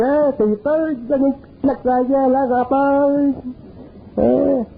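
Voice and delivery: the little voice taunting the timid man; mocking inner voice. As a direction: sneer at him for being scared. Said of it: "t'as dit".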